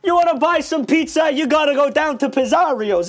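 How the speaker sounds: high-pitched hammed-up New York accent; different accent. New Yorker plugs Pizzario's in a mock accent. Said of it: "with a different accent".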